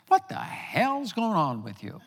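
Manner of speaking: high, drawn-out, incredulous demand; exasperated demand. Retold or imitated. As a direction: retell it as a loud, exasperated confrontation. Retold confrontation in the kitchen.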